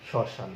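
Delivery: শ্মশান as "shoshan" with a light nasal touch; a little nasality after the first sh in শ্মশান; slightly nasal sh-word. Shown as a demonstration. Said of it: "একটু নাসিক্য আসলো".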